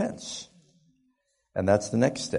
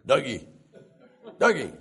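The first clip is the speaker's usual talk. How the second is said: dad calling out; 5 semitones higher, 3 dB louder than usual. Retold or imitated. Dad calling the sleeping boy.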